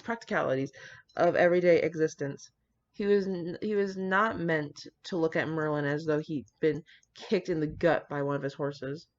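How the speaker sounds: just read the story out steadily; story narration. Acted. Reads fan fiction aloud.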